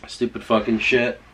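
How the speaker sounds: normal talking voice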